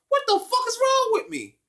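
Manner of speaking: high-pitched mock outrage at himself; incredulous at himself. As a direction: gasp at your own past self, voice flying up. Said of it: "I was like".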